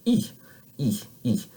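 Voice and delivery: "E" as a pure French ee; French tense ee. Shown as a demonstration. Non-native teacher modelling the third sound, tense "E". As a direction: separate steady "E" sounds. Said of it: "I'm doing it as closely as I can".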